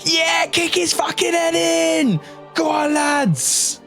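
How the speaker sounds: high rowdy cheering yell; cheering crowd. Acted. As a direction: egg on the fight.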